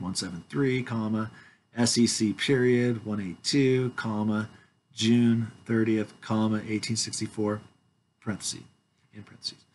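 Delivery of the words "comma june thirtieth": usual speaking voice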